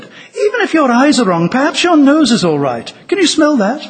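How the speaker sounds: lightly acted persuasive appeal; earnest coaxing. A narrator's reading. Lucy coaxes the dwarf.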